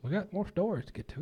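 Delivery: normal talking voice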